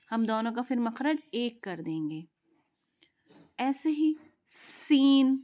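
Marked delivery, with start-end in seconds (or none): 3.6-5.4 seen with a long hissing सीन; slow sibilant सीन, stretched hiss; whistled seen. Shown as a demonstration. Teacher shows the whistle in सीन, drawn out. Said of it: "सीन में भी सीटी की आवाज़ होती है".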